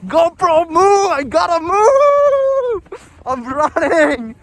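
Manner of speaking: high, frantic yelling at the GoPro; mock-panicked shout to the camera; imitating her panic. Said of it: "She's like".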